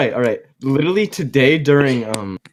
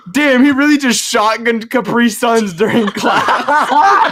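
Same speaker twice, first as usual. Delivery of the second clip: laughing quote; 5 semitones higher, slower than usual. Retold or imitated. Retelling his own reaction.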